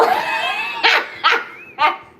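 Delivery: long, high, loud laugh; laughing at her little one. Real, not acted.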